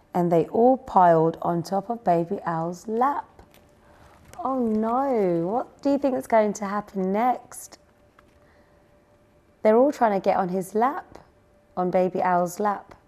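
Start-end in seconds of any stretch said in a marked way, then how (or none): none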